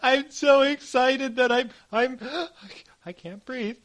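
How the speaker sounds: put-on high, breathless old lady fading out; having a heart attack. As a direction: play a granny gasping for air. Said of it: "I'm having a heart attack".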